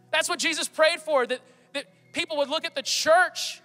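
rising pulpit fervor; full-force preaching. Acted. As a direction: build to a fervent call to the church.

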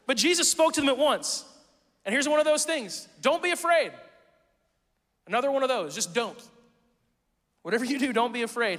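loud, high, fervent preaching; full-force preaching. Acted. Pastor preaching loudly to the church about fear. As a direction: lift the room as you drive it home.

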